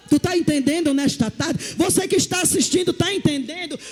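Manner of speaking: rally the congregation; sermon shout building; fervent preaching. Real, not acted. Preaching at full force.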